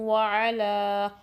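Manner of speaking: व अला very slow, the nasal ghunna given time; slow recitation; ghunna merge. Shown as a demonstration. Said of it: "और time भी देंगे".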